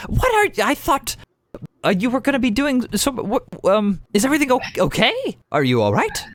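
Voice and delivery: very high, anxious questions; very high and fretting; worried brother. Acted. Brother fussing over his returned sister, very high. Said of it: "leans back and just goes".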